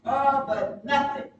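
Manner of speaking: ordinary talk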